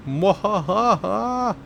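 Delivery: slow, high evil cackle; a gloating villain laugh; mad scientist. Acted. Evil laugh after successful takeoff.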